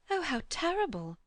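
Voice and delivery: shocked, pitched high; Lucy gasping out her horror; horrified cry. A narrator's reading. Lucy reacts to the old man's story, high horrified exclamation. Lucy hears of the wife's death.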